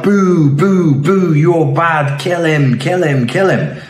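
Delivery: high, loud mock mob jeer; jeering shout. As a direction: heckle like a baying crowd. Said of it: "and shout".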